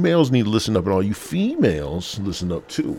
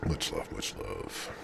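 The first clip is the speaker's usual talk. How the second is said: breathy near-whisper, much softer and lower; whispered thanks; 13 semitones lower, 16 dB quieter than usual. Real, not acted. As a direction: murmur the thanks under your breath. Closing shout-out, trailing off.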